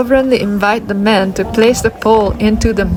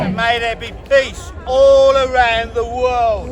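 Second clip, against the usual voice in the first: ritual call; slower than usual. Acted. Leader calls final line.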